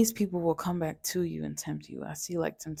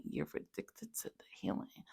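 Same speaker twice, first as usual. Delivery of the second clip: whisper; 9 semitones lower, 12 dB quieter than usual. Shown as a demonstration.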